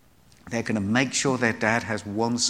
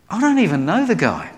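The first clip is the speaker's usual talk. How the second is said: louder, higher brush-off; loud denial; 8 semitones higher, 6 dB louder than usual. Retold or imitated. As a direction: deny him loudly and fast. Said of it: "Peter had three times said".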